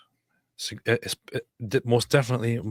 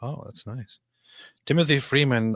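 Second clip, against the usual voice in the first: usual speaking voice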